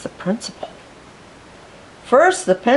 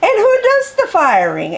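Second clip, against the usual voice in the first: high, loud, scornful dragon voice; fiery dragon librarian; 14 semitones higher, 3 dB louder than usual. Acted. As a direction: snap it back like a proud dragon lady. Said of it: "asked Miss Scales with a glare".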